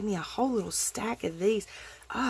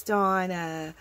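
usual speaking voice

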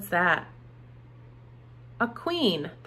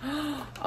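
surprised gasp; 5 semitones higher, 9 dB quieter than usual. Acted. Teacher reveals mystery bag item.

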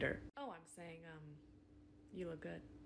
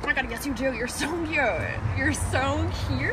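loud, tipsy flirting; 7 dB louder than usual. Acted. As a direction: come on strong, loud and sloppy.

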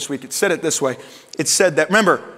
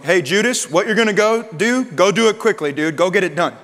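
raised, brisk mock command; mock casual order; 3 semitones higher, 3 dB louder than usual. Jesus imagined ordering Judas off, mock casual command. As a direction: imitate someone loudly sending a buddy off. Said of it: "you might think he's going".

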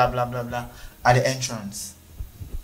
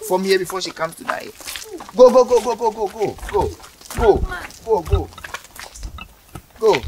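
shooing shout; 7 semitones higher than usual. Acted. Officer shooing customer away.

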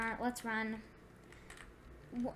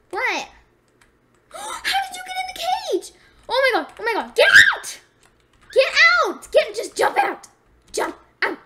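panicked high screaming; frantic shrieks at a trapped friend; breathless fright; 11 semitones higher, 12 dB louder than usual. Real, not acted. Friend trapped in elephant cage.

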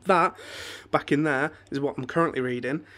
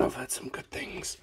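whisper; 4 semitones lower, 11 dB quieter than usual. Real, not acted. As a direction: lean in and whisper it. Hushed aside about anime.

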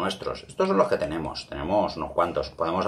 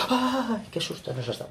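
fake fright; 14 semitones higher, 6 dB quieter than usual. Shown as a demonstration. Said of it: "vamos a hacer como que".

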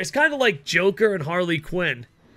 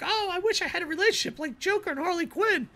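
mocking fangirl voice; 13 semitones higher, 4 dB quieter than usual. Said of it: "they're like".

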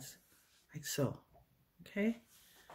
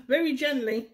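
higher voice breaking with laughter; laughing; 8 semitones higher than usual. Real, not acted. Tutor laughing at her own clumsy handling, laughing. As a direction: try to say it through real giggles.

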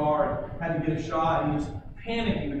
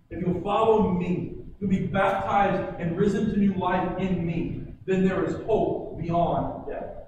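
louder, slowing toward the end; full-force preaching; 2 semitones higher, 4 dB louder than usual. Acted. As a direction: drive the promise home from the pulpit. Preacher builds again, quoting God.

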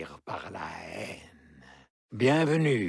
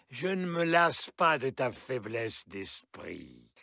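normal talking voice

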